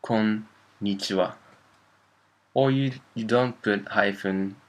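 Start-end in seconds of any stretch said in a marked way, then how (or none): 0.0-1.4 konnichiwa split slowly as kon, nichiwa; separated N's. Shown as a demonstration. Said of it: "Between kon".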